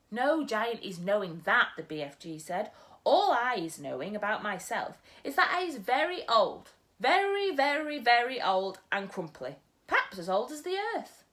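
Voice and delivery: a gentle giant muddling his words; storyteller's BFG. Acted. BFG about his age.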